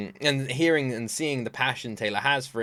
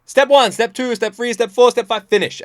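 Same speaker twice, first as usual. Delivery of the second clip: much higher and louder, ticking off steps; mock lecture countdown; 10 semitones higher, 10 dB louder than usual. Taylor lecturing fans at a board, loud and high. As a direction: bark out each step like a schoolteacher. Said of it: "pulls down a board and goes".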